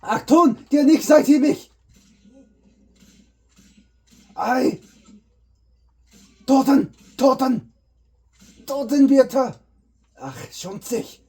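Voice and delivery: high, loud barked mock-German shouting; mock German soldier. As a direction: yell fake German like a cartoon guard. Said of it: "all of a sudden speaking German".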